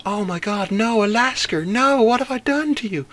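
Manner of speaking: distressed woman. Retold or imitated. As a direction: ham up her horrified cry to the dog. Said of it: "the way she said it was".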